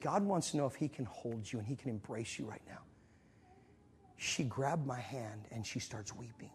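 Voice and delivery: low, very soft delivery; tender hush. Retold or imitated. Traveler telling lady God would hold her, tender hush. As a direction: offer comfort gently, sinking almost to a whisper.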